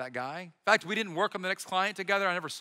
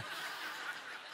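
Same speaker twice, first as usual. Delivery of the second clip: breathy laugh; 15 dB quieter than usual. Real, not acted. Laughs at own joke.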